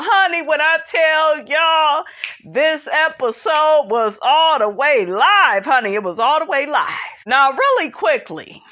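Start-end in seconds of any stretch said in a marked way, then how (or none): 2.5-5.7 slow, stretched "live"; slow emphatic. Shown as a demonstration. Host telling viewers the episode was wild, slow emphasis. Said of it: "emphatic repeat". 5.7-6.9 quick, tossed-off "all the way live"; fast repeat. Shown as a demonstration. Host repeating to viewers the episode was wild, fast. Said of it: "emphatic repeat".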